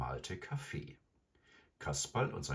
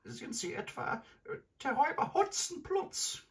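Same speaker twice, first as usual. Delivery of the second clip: put-on very high, timid old lady; fearful grandmother. A narrator's reading. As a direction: ask hesitantly in a frightened high voice. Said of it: "mit ängstlicher Stimme fragte sie".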